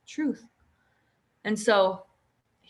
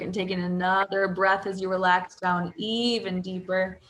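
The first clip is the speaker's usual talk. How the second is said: ordinary talk, nothing marked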